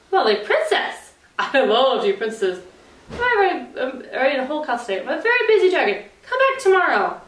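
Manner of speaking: high, fast put-on dragon voice; a busy dragon brushing her off. Dragon shooing the princess away, high and quick.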